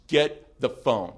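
slow, loud command, no argument; firm order. Retold or imitated. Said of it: "He just says".

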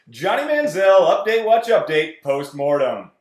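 loud booming announcer shout; shouted segment title. Acted. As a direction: bellow the segment name.

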